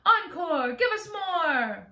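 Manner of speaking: high, loud cheer with a drawn-out ending; shouted cheer. A narrator's reading. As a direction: call out loudly for an encore. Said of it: "stood up and shouted".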